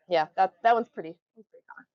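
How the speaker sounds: usual speaking voice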